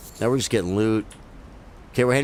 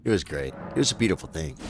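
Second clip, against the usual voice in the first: ordinary talk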